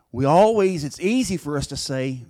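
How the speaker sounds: usual speaking voice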